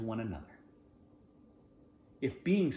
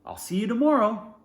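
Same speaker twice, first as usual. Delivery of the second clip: high, bright-voiced farewell; cheerful goodbye; 9 semitones higher, 6 dB louder than usual. Jack saying goodbye to Sebastian, cheerful and high. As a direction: call it out gladly. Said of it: "Jack says".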